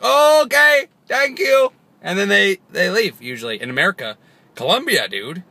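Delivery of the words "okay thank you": imitating cops. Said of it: "they're like".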